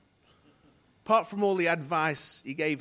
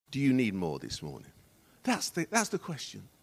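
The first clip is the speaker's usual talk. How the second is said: ordinary delivery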